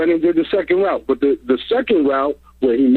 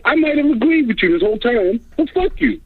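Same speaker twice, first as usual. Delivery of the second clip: higher mock owner, rising to a sharp high brush-off; imitated owner; 6 semitones higher than usual. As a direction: play the offended owner telling him off. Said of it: "I'm gonna be like".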